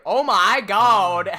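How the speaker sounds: slow, loud, laughing "oh my god"; amused disbelief at the glitch. Real, not acted. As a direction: stretch it out through a laugh.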